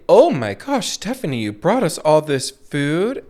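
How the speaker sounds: high, gushing mock thanks; gushing thank-you. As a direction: gush over the food like a grateful guest. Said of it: "if someone would say".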